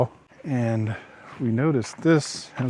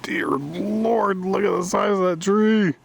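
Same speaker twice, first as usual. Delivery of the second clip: high amazed exclamation; 8 semitones higher than usual. Real, not acted. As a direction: gasp at the giant tree. Amazed by a huge tree.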